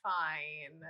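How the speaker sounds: ordinary delivery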